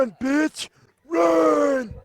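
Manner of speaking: put-on high, loud croaky old man. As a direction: shout it like a frantic old man. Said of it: "it was a good voice".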